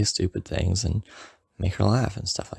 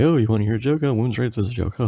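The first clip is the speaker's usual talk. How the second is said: hammed-up, higher silly delivery; mock joke teller; 5 semitones higher, faster than usual. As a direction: parody someone telling a dumb joke.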